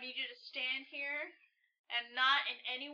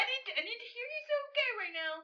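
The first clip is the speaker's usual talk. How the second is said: high, rushed insistence; anxious, quick demand climbing in pitch; urgent pleading; 9 semitones higher, 4 dB louder, faster than usual. Acted. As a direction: press your friend hard for an answer. Pressing friend to confirm.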